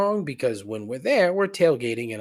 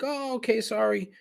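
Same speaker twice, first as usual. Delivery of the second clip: much higher, conciliatory apology; high-pitched mock-polite sorry; retold polite apology; 11 semitones higher than usual.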